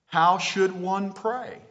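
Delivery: ordinary talk, nothing marked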